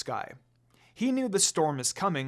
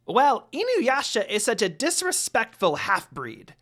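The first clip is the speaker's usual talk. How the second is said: very high, loud squawky grumble; angry complaint; 6 semitones higher, 6 dB louder than usual. A narrator's reading. Jokin glaring around, loudly complaining. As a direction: complain like an outraged imp. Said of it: "Jokin complained".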